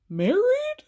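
"Married" soaring high into a question; incredulous echo. Retold or imitated. Friend stunned by the married men jab, incredulous. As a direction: squeak the word in exaggerated disbelief. Repeats it in mounting disbelief.

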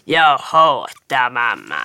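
low, slow grumpy moan; grumpy smurf. Retold or imitated. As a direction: drag it out like a sulky Grumpy Smurf. (långsam, låg sur buttesmurfsröst)

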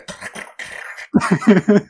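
breathy burst of laughter; loud laugh. Real, not acted. Laughing at his own creaky knees. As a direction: crack up at yourself.

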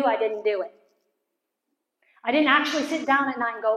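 normal talking voice

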